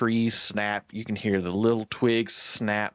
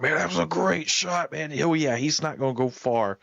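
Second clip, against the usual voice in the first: higher, pumped-up praise; excited guide; 6 semitones higher than usual. Retold or imitated. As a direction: cheer the shot like a thrilled guide. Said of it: "He was so excited".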